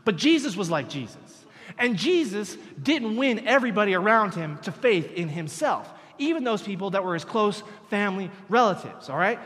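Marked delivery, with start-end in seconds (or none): 1.8-9.4 loud, driving sermon push; emphatic preaching. Acted. Preacher insisting to the congregation, loud and emphatic. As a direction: press the point home to the church.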